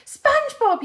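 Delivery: high, loud alarmed yell. A narrator's reading. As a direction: shout his name in alarm. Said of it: "yelled Patrick".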